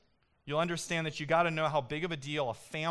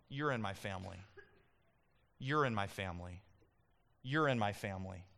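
softer and lower, gently repeated; tender; 5 semitones lower, 4 dB quieter than usual. Acted. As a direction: reassure someone who feels left out. Jesus speaking softly to ordinary person.

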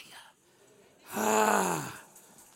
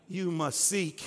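ordinary talk

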